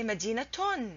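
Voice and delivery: مدينتون said "madinatun", t before the -un ending; ta sound kept. Shown as a demonstration. Teacher adds two dhammas, مدينتون with the t still pronounced. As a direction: finish مدينتون on "tun". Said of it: "you still pronounce it تا".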